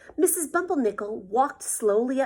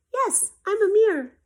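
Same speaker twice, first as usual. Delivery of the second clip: put-on new boy; 11 semitones higher, 7 dB louder than usual.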